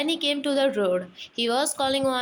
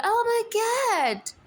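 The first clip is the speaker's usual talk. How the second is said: mock lament; 11 semitones higher, slower than usual. Wife laments cat leaving.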